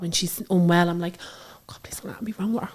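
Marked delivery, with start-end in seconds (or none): 1.2-2.7 breathy whispered prayer, much softer; panicked whisper. Retold or imitated. Mum retelling her panic when her daughter is unwell, whispering. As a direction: whisper it like a frantic plea.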